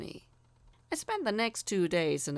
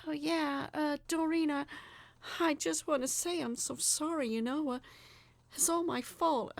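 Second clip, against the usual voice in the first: higher, halting, flustered voice; rambling apology; 9 semitones higher than usual. A narrator's reading. Narrator rambling sorry to Doreen, flustered and halting. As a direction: stumble over the apology. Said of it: "I rambled".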